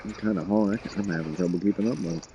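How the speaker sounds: talking around a vape; words muffled by a vape hit; vape in mouth. Real, not acted. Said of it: "Excuse the vape".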